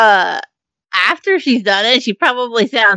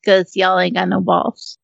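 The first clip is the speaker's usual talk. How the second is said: ordinary talk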